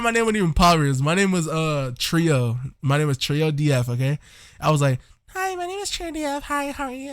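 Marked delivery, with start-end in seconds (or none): 5.3-7.1 very high, soft, bad girly voice; badly faked squeaky feminine greeting; mock your old falsetto girl voice; terrible early voice. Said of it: "It was so bad".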